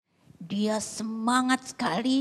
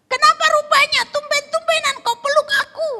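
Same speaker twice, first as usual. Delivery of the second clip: high, loud incredulous question; startled wife; 12 dB louder than usual. Acted. Puzzled wife questioning her husband's sudden hug, high loud surprise. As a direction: demand to know, high and loud.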